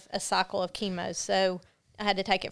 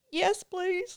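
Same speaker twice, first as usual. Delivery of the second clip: meek relieved reply; 10 semitones higher than usual. Retold or imitated. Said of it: "I was like".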